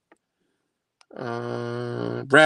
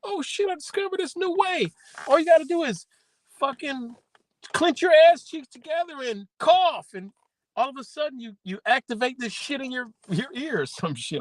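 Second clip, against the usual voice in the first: much higher, thrilled explaining voice; excited discovery; 13 semitones higher than usual. Pretend. Explaining the new trick to Kimmy, much higher and excited. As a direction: spill the secret, buzzing with excitement. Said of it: "She'd be like".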